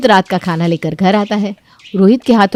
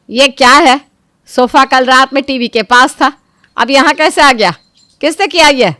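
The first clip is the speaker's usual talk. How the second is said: higher, loud scolding mother-in-law; angry mother-in-law; 5 semitones higher, 6 dB louder than usual. Acted. Mother-in-law scolding the household over a moved sofa, angry. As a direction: bark out like a bossy saas.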